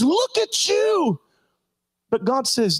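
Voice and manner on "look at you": high, slow, drawn-out accusing taunt; mocking devil. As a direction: stretch it out high like the devil sneering. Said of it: "and says".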